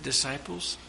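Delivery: a lightly voiced Jesus, hushed; hushed aside. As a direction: lean in and hush them. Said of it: "Jesus said".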